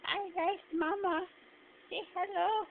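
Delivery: high sing-song pet voice. Real, not acted. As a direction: coo at the kitten. Baby talk to the cat.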